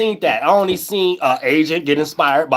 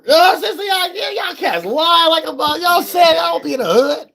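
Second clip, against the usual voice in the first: laughing rant; 12 semitones higher than usual. Real, not acted. Laughing, shouting rant at liars.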